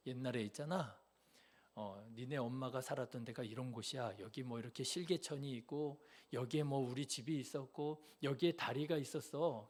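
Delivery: soft, hushed storytelling uncle; convincing uncle. Retold or imitated. Uncle drawing a map for his nephew, hushed and convincing. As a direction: lay out the fake map quietly and earnestly. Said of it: "얼마나 그럴듯하게 얘기를 하는지".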